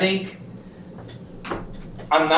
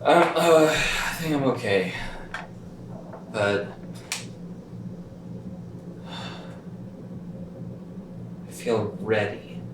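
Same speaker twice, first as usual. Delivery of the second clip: dazed; 4 semitones lower, 9 dB quieter than usual. Acted. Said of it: "I'm a little dizzy".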